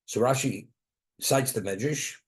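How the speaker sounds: ordinary delivery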